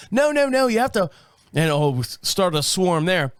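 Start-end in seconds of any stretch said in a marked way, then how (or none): 0.1-1.1 higher, insistent British clerk; mock British agent insisting; British accent. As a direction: correct him in a British accent. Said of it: "'cause she's British".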